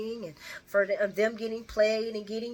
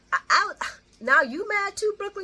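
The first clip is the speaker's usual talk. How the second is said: exasperated; 12 semitones higher, 6 dB louder than usual. Real, not acted. Teasing Brooklyn on screen.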